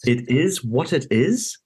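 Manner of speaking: slow incredulous echo of a heartless remark. Retold or imitated. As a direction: throw his words back in disbelief. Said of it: "And I was like".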